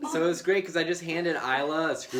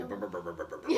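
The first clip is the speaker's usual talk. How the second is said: car motor noise; 7 semitones lower, 8 dB quieter, much faster than usual. Retold or imitated.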